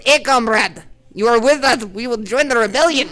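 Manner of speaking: hammy Russian comrade voice; Russian accent. Retold or imitated. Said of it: "he talks in a really bad Russian accent".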